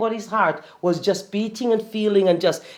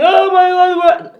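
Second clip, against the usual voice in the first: high, loud panicked outcry; mock alarm; 8 semitones higher, 6 dB louder, slower than usual. Coach acting someone hit by bad news, alarmed. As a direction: act out a dismayed reaction. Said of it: "something happens bad to you and you think".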